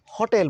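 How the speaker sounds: "होटेल" with open 'haw' and long 'tel'; correct pronunciation. Shown as a demonstration. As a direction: say "होटेल" as haw-TEL. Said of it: "बोलते हैं". ("होटेल" को 'हॉटेल' बोलें, हल्का खुला 'ऑ')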